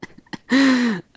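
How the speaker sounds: a burst of high, bright laughing; real laughter. Real, not acted. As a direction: laugh out loud at the joke. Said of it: "I'm laughing because".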